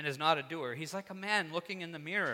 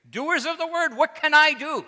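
loud, much higher preacher's cry; imitated forceful preaching; 13 semitones higher, 14 dB louder than usual. Recalling a sermon he once heard, imitated loud preaching. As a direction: cry it out like a preacher challenging the pews. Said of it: "preached very plainly".

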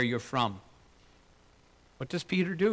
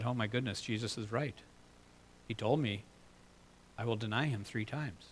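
much softer, hushed dawning horror; dismayed realization; 6 semitones lower, 11 dB quieter than usual. Retold or imitated. Peter realizing the rooster warning, hushed. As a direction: say it under your breath as it sinks in.

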